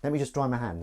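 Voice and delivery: normal talking voice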